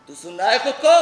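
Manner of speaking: loud shout, rising at the end; shouted, outraged question; angry reproach. Acted. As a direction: raise your voice to make him hear himself. Mother repeats it, now shouting.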